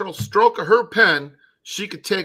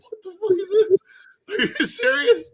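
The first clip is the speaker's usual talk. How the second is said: much higher, incredulous mock outrage; squeaky retold disbelief; outraged retort. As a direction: throw up your hands in disbelief. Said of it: "I was like".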